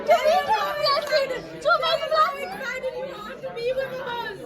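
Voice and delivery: squealing, playful interviewer; excited. Real, not acted. Interviewing excited guest loudly.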